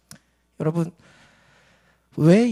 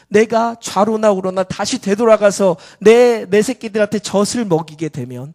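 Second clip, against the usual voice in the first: loud, raised, driving sermon voice; full-force preaching; 4 semitones higher, 10 dB louder than usual. Real, not acted. Preacher urging congregation about the cow pressing on, full-force preaching. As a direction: drive each phrase home with urgent volume.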